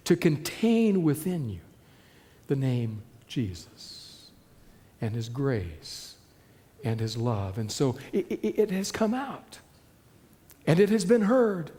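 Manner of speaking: usual speaking voice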